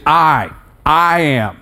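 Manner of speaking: very slow "I", long pause, then "I am"; emphatic I. Shown as a demonstration. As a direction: give "I" its own weighty beat. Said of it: "it's the emphatic use of".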